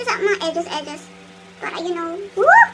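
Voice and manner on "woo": pained whoop. Real, not acted. Peel-off mask pain.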